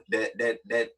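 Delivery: ordinary talk, nothing marked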